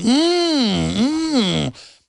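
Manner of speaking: playful mock admiring hum; imitated admiring moan. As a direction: hum it like ogling someone. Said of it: "They were going".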